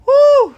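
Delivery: high loud whoop; loud delighted woo; excited whoop. Real, not acted. Excited whoop mid-stream.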